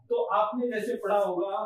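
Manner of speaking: ordinary talk, nothing marked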